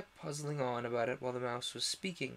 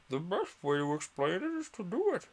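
lightly voiced pompous bird; a hint of a self-important dodo; 5 semitones higher, 5 dB louder than usual. A narrator's reading.

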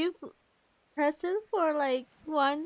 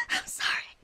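whisper. Real, not acted. Apologizing to the game character.

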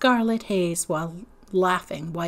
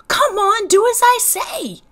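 high, loud big-brother command; insist, losing patience; impatient order; 13 semitones higher, 8 dB louder than usual. Acted. Stacy shouting down at little man, impatient and loud.

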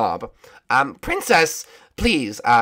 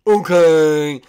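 loud drawn-out cartoon princess; Raggedy Princess; 6 dB louder than usual. Princess agrees to go to the Ice Kingdom, cartoon voice. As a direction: sing out a put-on princess reply. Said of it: "All right, Raggedy Princess".

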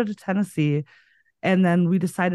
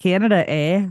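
mock Canadian; 4 dB louder than usual. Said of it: "extremely Canadian".

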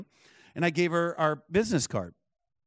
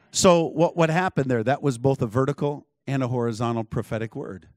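usual speaking voice

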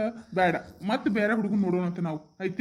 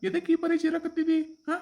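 high-pitched, exasperated query; acted father's testy questions; irritated questioning; 8 semitones higher than usual. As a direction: demand to know what the fuss is. Father irritated at being yelled for.

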